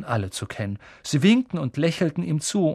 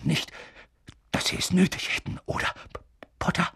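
halting, broken, jittery phrases; anxious professor, voice dropping away; nervous stammer; 4 semitones higher, 4 dB quieter, faster than usual. A narrator's reading. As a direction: falter and stick on the name. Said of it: "Er lachte nervös".

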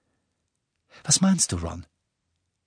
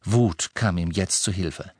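ordinary delivery, nothing marked

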